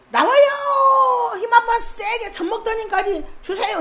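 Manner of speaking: high, eager midwife cheering a birth on; urging cry. Acted. Midwife urging a labouring mother, high and eager. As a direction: push her on like a midwife.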